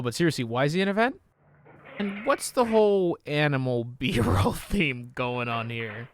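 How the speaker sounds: usual speaking voice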